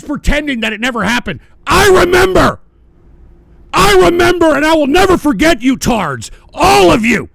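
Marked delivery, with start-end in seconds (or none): 1.7-2.6 high, loud yell; shouting a grudge; furious shout. Real, not acted. Angry rant at viewers. 3.7-7.3 high, loud yell; shouting a grudge; furious shout. Real, not acted. Angry rant at viewers.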